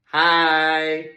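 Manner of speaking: loud answer from the pews; loud reply. Shown as a demonstration. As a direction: join the crowd's reply. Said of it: "And what do you say?".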